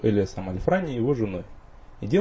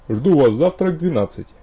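loud; 6 semitones higher, 9 dB louder than usual. Acted. Rector calls loudly.

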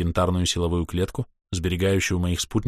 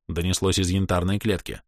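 plain reading voice

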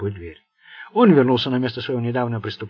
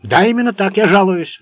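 loud, higher roar; 7 semitones higher, 9 dB louder than usual. A narrator's reading. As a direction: bellow it back at him. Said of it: "взревел Сеймур".

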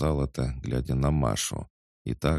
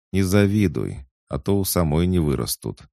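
ordinary delivery

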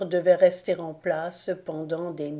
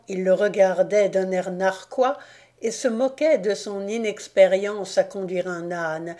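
plain reading voice

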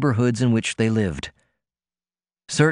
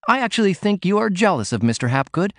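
Ruth chiming in; 9 semitones higher than usual. A narrator's reading. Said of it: "Ruth had chimed in".

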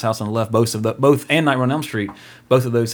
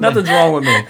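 high, loud, giggly protest; laughing, raised-pitch comeback; laughing denial; 6 semitones higher, 5 dB louder than usual. Real, not acted. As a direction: brush off the teasing through real laughter. Laughing off co-host's teasing question.

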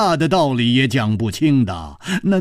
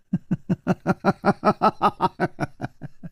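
short soft scornful laugh; a cold sneering chuckle; 5 semitones higher, 5 dB quieter than usual. Acted. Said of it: "这个女子就冷笑起来".

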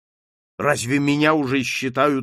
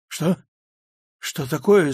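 raised, startled questions; 4 semitones higher than usual. A narrator's reading. Dazed marquis answering his wife, startled. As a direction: answer as if jolted awake. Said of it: "вздрагивая".

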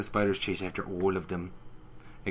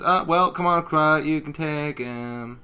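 higher, louder, slowing; mock nervous egging on; 6 semitones higher, 5 dB louder, slower than usual. Rainbow Dash urging a friend on, mock uneasy. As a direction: nudge someone forward uneasily. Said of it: "She goes".

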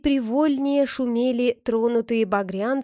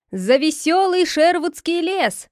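raised, higher-pitched rallying toast; exclaimed toast; 5 semitones higher, 4 dB louder than usual. A narrator's reading. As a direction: call out a toast to the whole band. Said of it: "воскликнул он".

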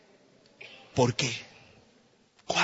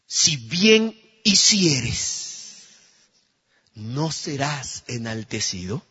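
slow scripture reading; 4 semitones lower, much slower than usual. Shown as a demonstration. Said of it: "Miren lo que dice el verso siete".

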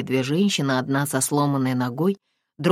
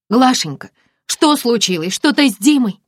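put-on higher, louder worried girlfriend; alarmed friend; 9 semitones higher, 9 dB louder than usual. Friend hears her crying, anxious and high. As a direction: jump into worry for her son.

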